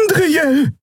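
very high, urgent cry; calling out. A narrator's reading. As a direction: shriek the name as a warning. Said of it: "rief Xea".

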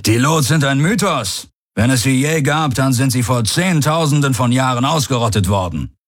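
high, emphatic rebuttal; incredulous commander. A narrator's reading. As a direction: dismiss it as a myth.

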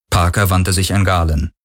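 plain reading voice

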